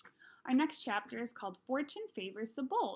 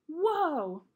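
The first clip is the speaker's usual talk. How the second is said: impressed whoa; 5 semitones higher, 5 dB louder than usual. Acted. Boys react to sled price.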